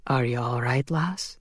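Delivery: low, soft concern; lightly voiced Connor. Connor checks on heroine.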